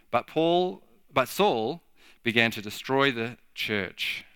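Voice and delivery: reading Acts aloud to the congregation; scripture reading. Acted. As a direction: read the passage with gravity. Reads Acts chapter eight aloud.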